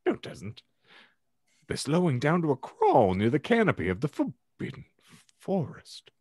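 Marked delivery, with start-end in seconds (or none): none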